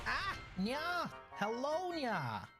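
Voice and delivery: high, bright call of welcome; excited greeting. Real, not acted. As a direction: greet a friend arriving, delighted. Greeting friend joining stream.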